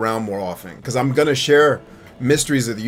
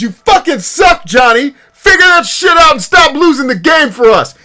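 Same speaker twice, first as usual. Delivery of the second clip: high, full-force coach's shout; yelling; 14 semitones higher, 10 dB louder than usual. Acted. Coach to Johnny losing the game, angry yelling. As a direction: chew out a teammate at the top of your voice. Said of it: "I'm not gonna yell".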